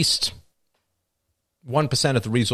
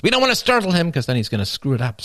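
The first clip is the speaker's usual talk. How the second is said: higher, fast mock-anxious aside; mock urgent warning; 7 semitones higher, 3 dB louder, faster than usual. Inner voice afraid of startling the golfer, mock urgent. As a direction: blurt the warning quickly and higher. Mock golf announcer breaks the hush.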